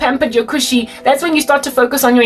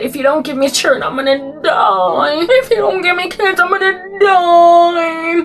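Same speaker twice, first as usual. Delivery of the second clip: hammed-up wail climbing ever higher into fake crying; mock wailing; 8 semitones higher than usual. Entitled wife threatening her husband, hammed-up rising wail. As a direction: ham it up, pitch soaring into a fake sob.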